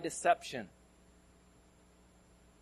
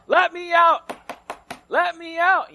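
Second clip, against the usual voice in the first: high loud yell of okirama-free; trapped kid yelling; 13 dB louder, slower than usual. Retold or imitated. Trapped friend yelling from closet.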